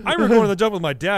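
ordinary talk, nothing marked

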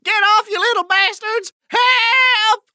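high, loud panicked scream for help. Acted. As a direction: scream as if swarmed by rats. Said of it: "I'm going to start screaming".

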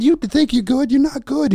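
very high, loud mock taunting; imitating worked-up opponent. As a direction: hurl it back in a worked-up squeal. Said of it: "He's, like, getting all worked up".

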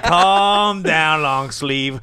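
high, loud opening, easing on the outfit jab; playful shouted put-down; roast catchphrase. Retold or imitated. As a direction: call out a mock roast. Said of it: "roasting people always with".

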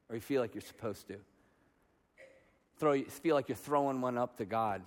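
low, very soft, searching preaching; hushed confrontation. Real, not acted. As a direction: quietly press the congregation to reflect. Preacher gently confronts congregation.

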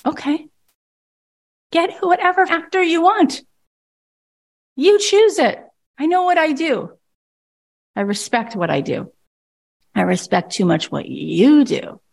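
an actor standing up for her worth, haughty and defiant. Retold or imitated. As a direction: toss it back like a self-respecting star. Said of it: "It's like".

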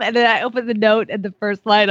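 usual speaking voice

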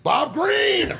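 very high, loud shouted name; Barnett's shout. Retold or imitated. Host shouting the coach's catchphrase, very high. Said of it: "only one thing I can do for Barnett".

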